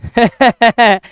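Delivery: short burst of chuckles; amused teacher's laugh. Real, not acted. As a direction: chuckle in a quick series. Teacher laughs amid lesson.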